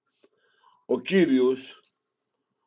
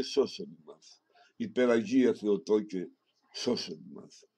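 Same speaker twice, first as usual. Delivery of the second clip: prayer; 3 semitones lower than usual. Acted. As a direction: murmur the litany devoutly and low. Closing prayer.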